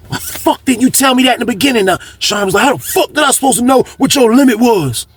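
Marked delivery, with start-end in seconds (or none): 0.1-1.9 high-pitched mock argument; imitated indignation. Retold quarrel over the limit. 2.6-5.1 high-pitched mock argument; imitated indignation. Retold quarrel over the limit.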